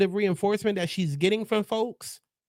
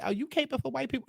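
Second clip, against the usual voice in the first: imitating a critic, higher and softer; mock critic; 8 semitones higher, 8 dB quieter than usual. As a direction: toss it off like a snide commenter. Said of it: "before anybody try to say".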